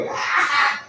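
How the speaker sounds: short breathy mocking laugh; mocking laugh of the adversary; jeering laugh. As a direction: laugh at the fool. Devil laughing inside his taunt.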